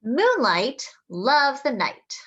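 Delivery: slow, lilting storybook voice; storybook reading. Acted. Reading a picture book to children, slow and lilting. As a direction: read it slowly to little ones.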